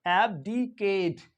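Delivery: abdicate stretched very slowly, syllable by syllable; slow pronunciation demo. Shown as a demonstration. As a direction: sound out abdicate for learners. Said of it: "तो ये हो जाएगा ab".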